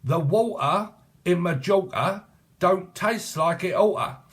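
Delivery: high, slow, put-on proper-speech voice, with "don't" and "oughta" drawn out; mock elocution voice. Shown as a demonstration. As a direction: ham up "don't taste like it oughta" as an elocution lesson.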